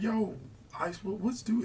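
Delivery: high-pitched, puzzled quote; retold bafflement. Asking his friend why the man is mad, puzzled. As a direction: lightly act out baffled questioning.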